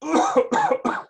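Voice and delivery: sharp cough of a man still sick. Acted. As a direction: cough hard before speaking. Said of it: "He coughs occasionally because he is recovering from a bad cold".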